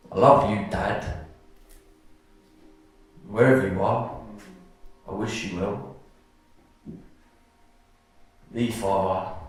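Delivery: measured, low recital with weighted pauses; poem reading. Acted. Poet reciting to an audience about a father, measured poem reading. Reads own poem aloud.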